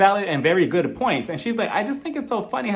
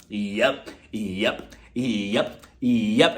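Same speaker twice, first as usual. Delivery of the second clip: low Erica yep; 6 semitones lower, much slower than usual. Retold or imitated. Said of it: "Here comes Erica".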